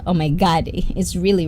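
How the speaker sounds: ordinary talk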